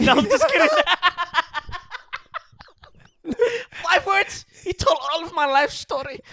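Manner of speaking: laughing high and loud. Real, not acted. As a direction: burst out laughing, pitch up high. Laughing at friend's summary.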